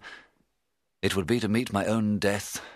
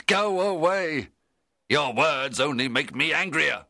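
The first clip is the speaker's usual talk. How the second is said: loud, high, slow angry shout; 9 semitones higher, 4 dB louder than usual. Acted. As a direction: drive him off in fury. Narrator shouts at monster.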